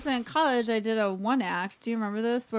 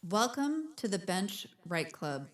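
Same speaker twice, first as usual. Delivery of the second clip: slow, solemn club-rules announcement; mock announcer; 4 dB quieter, slower than usual. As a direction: intone the rules with measured weight.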